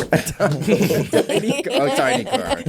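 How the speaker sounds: high giggling repeat; laughing. Real, not acted. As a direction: squeal it through laughter.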